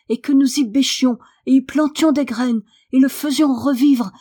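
high, loud, tumbling excitement; breathless urgent pleading. A narrator's reading. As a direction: pour out the idea as if panting with urgency. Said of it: "Marie s'expliqua à mots précipités".

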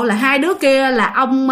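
ordinary delivery, nothing marked

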